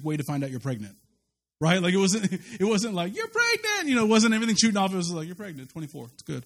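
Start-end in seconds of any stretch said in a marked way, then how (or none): 3.1-3.9 mock grand announcement. Imagining the big moment, a hammed-up announcement. 5.3-6.4 much softer, lower, bored doctor; flat deadpan. Retold or imitated. The doctor telling them flatly, deadpan.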